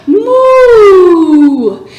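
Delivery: loud playful moo; cow moo. Shown as a demonstration. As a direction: moo like a cow to show the kids. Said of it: "what sound does the cow make".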